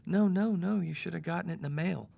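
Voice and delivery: high, insistent "no, no, no" as the assistant; mock high assistant voice. Assistant voiced in retold quarrel.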